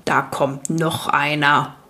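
low, slow creaking growl of a stone giant; creaky rock-biter voice. Acted. Rock biter greets the newcomer by the fire, slow creaky rumble. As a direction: grind the words out slowly like rock. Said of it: "sagte er mit knarrender Stimme".

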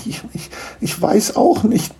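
high, stammered helplessness; falter, at a loss; feeling terrible. Acted. Niklas has no answer for the gnome family, helpless. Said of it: "Er fühlte sich ganz schrecklich".

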